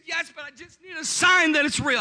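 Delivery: high, loud pleading wail; mock weeping. Wife answering pastor about prayer, mock tearful plea. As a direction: plead loudly as if crying, hammed up. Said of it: "She starts weeping".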